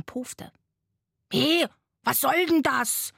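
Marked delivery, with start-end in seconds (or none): 1.3-3.1 higher, indignant sloth outburst; angry shout. A narrator's reading. Sloth woken by tears, angry raised voice. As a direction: call out crossly, drawing it out. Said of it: "rief es wütend".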